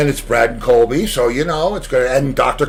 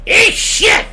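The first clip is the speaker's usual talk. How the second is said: much higher, louder parrot screech; loud high parrot squawk; defiant parrot squawk; 7 dB louder than usual. Retold or imitated. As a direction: screech it back like a stubborn parrot.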